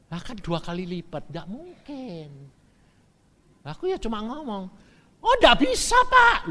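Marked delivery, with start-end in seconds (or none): 5.2-6.5 much higher and louder, a quick brush-off; seller's first raised no; high-pitched refusal. Retold or imitated. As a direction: turn him down in a high, loud voice.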